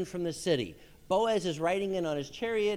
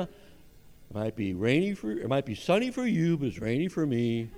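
Eeyore; 7 semitones lower than usual. Acted. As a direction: drag it out low and gloomy. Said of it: "has anybody ever heard of Eeyore?".

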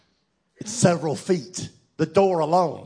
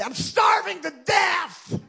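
much higher, loud, drawn-out mock wail; hammed-up starving teen; 14 semitones higher, 4 dB louder, slower than usual. Pastor imitating his hungry teenage son, loud mock wail. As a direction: ham up a hungry teenager's overdramatic complaint. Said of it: "and he'd say".

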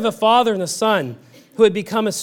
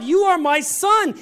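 proclaimed scripture; 9 semitones higher than usual. Acted. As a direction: declare it as a triumphant truth. Repeating God's words with fervour.

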